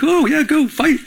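high, playful cheering-on; egging on. Acted. Pastor cheering church members defending him online, egging on. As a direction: cheer them into the fight, jokingly.

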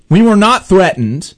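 normal talking voice

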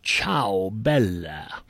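low, soft suave Italian robot; lightly voiced Italian flirt; mock Italian. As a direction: croon it slowly with a put-on Italian accent. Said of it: "speaks French or Italian".

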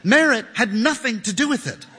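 usual speaking voice

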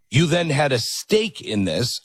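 ordinary talk

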